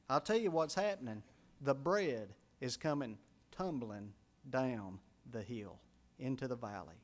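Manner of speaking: normal talking voice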